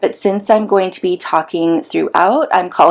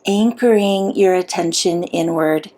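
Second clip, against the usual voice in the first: unhurried meditation guide with long pauses; soothing, measured visualization voice; guided meditation; 3 dB quieter than usual. Acted. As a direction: guide them gently inward, leaving long silences.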